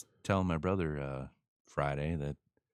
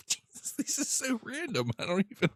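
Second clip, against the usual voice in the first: laughing. Real, not acted. Laughs at random question.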